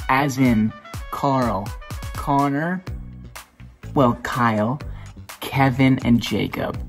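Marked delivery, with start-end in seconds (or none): none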